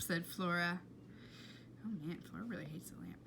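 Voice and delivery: ordinary delivery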